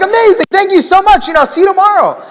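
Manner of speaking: very high, loud gushing thanks; overjoyed. Retold or imitated. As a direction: squeal with delight. Overjoyed at yes.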